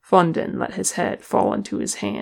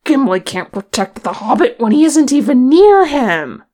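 loud, high shouted objection; heated, exasperated yell; angry retort; 7 semitones higher, 10 dB louder than usual. A narrator's reading. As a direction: shout it back, building to the end. Dwarf shouts back in uproar.

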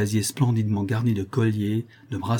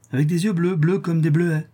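higher voice, falling into a sure answer; old crone confirming; 7 semitones higher than usual. Acted. Old woman confirming the child's eyes, crone's echo.

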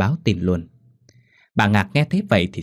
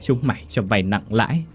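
put-on defiant old mother; 6 semitones higher than usual. Old mother defies her cruel son, rising into a furious cry. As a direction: spit it back at the son, swelling into fury. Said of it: "cắt lên".

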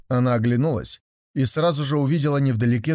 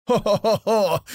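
high mocking horse laugh "ho-ho-ho"; horse neigh-laugh; 9 semitones higher than usual. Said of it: "громко заржала". (ржание лошади с хохотом)

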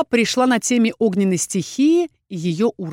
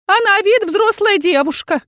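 much higher, louder chiding voice; a prim magic mirror scolding; magic mirror reproaching the grown-up witch; talking mirror; 12 semitones higher, 6 dB louder than usual. A narrator's reading. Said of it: "сказала зеркало".

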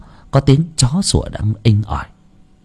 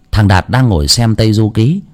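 plain reading voice

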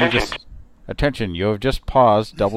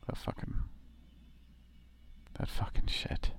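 whisper; 15 dB quieter than usual. Real, not acted. Whispered, unvoiced.

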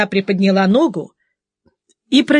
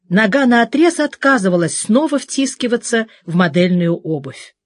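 ordinary delivery

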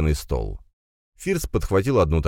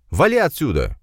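high, raised order; angry dismissal; 10 semitones higher, 4 dB louder than usual. A narrator's reading. Firs kicks out uninvited guest, angry. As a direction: order him out sharply.

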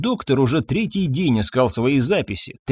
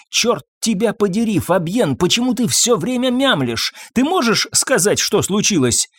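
high, impatient tirade; exasperated scolding; 8 semitones higher than usual. A narrator's reading. Wife demands her mumbling husband say what happened, exasperated. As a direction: scold him with raised pitch.

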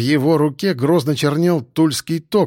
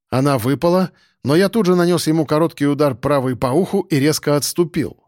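plain reading voice

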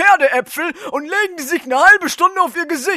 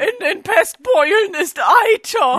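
escalating whine. Acted. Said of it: "Hör auf zu simulieren".

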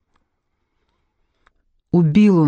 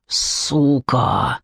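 hissed curse; 5 semitones lower than usual. A narrator's reading. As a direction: spit it through the teeth like a bitten man. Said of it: "прошепел он".

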